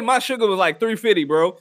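normal talking voice

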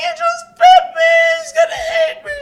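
high, loud tearful wail; crying out. Retold or imitated. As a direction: sob that the cat bit you. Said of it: "And I started crying".

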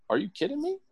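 very high-pitched mock disbelief; exaggerated, high incredulous question; acted incredulity. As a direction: squeak it out as if you can't believe it. Acted reaction to silly argument.

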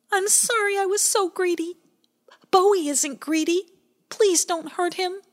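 high, scared plea; a frightened child begging for mercy; high shaky-free pleading voice; Pie Jam pleading. A narrator's reading.

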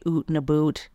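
"oot and a boot" with long oo vowels; exaggerated oo in "oot" and "boot". Shown as a demonstration. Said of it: "they also do say".

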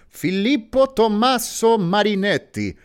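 rolling the name Filippo Tommaso Marinetti out slowly in a big, loud Italian accent, high and proud. Shown as a demonstration. Host shows off the name to his co-host in an Italian accent. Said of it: "let me use it in my Italian accent".

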